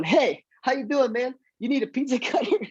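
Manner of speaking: high, overly chipper doorstep pitch; chirpy mock greeting with a laugh; awkward friendly greeting. Acting himself at the door.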